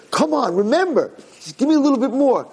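high-pitched insistent urging; urgent pleading. Acted. Old man coaxing the prince's memory back, urgent pleading. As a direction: push hard to jog a memory.

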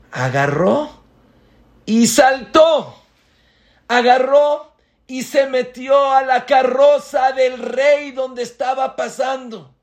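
high, loud, breathless storytelling; excited shout. Acted. Rabbi tells how the boy leapt onto the carriage, shouting excitedly. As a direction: let the jump burst out. Excited telling of boy's leap.

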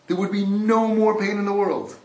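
loud, high and impassioned, leaning on "all"; a fervent shout that hits "all" hard; crying out. Acted. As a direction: preach "then all of our pain would go away" at full force, high and loud. Preaching climax.

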